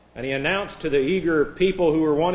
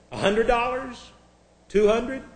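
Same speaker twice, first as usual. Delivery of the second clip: higher-pitched auctioneer prompting bids; hopeful call for bids, pitched high; 7 semitones higher than usual. Acted. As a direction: float each figure up as a question. Acted auctioneer fishing for bids.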